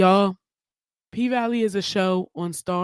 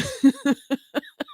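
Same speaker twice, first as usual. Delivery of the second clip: laugh; 8 semitones higher than usual. Real, not acted. Laughing at the grandma quote.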